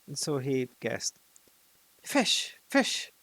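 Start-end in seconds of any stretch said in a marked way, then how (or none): 2.0-3.1 high breathless cry of fish; high, louder excited cry. A narrator's reading. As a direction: fling the word out in a high rush. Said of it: "he cried".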